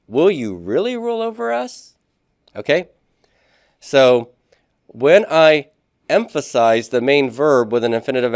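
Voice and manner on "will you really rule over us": "really" swooping up into a very high question; high-pitched, doubting "Will you really"; strengthened doubting question. Shown as a demonstration. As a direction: question the "really" hard, pitch soaring to the end. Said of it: "I'm strengthening the nature of the question".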